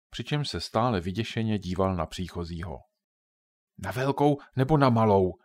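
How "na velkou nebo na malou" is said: usual speaking voice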